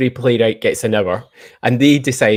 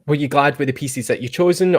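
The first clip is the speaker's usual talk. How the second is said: ordinary talk, nothing marked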